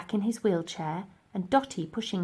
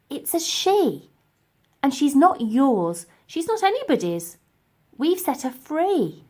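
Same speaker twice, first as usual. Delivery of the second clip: defiant Elsie; 6 semitones higher, 6 dB louder than usual. Acted. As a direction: stand up to the professor. Elsie defends the mammoth.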